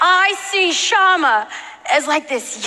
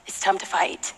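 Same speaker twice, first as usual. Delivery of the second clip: low, hushed call to battle; a hint of a grave king, soft and falling; imagined king's summons; 8 semitones lower, 6 dB quieter than usual. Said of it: "Maybe King David called his name".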